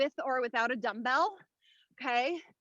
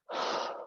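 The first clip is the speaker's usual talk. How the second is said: effortful sigh; 10 dB quieter than usual. Real, not acted. Mid-workout effort exhale.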